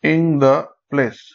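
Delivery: "in the place" very slowly, three beats; syllable by syllable. Shown as a demonstration. Teacher counting three syllables, slow and spaced out. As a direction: separate each syllable of "in the place". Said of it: "তিনটে syllable".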